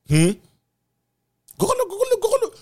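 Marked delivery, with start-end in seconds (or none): 1.6-2.5 very high squeaky gobble; mock turkey gobble.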